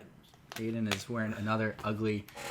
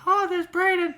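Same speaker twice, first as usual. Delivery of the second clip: put-on much higher, louder girlish cry; girl's voice; 7 dB louder than usual. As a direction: squeal it like a surprised girl. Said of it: "some girl's gonna be like".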